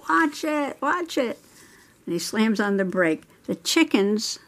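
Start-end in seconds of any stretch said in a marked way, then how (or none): 0.1-1.4 retold yell. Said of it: "and I yell".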